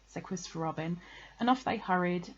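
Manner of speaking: plain reading voice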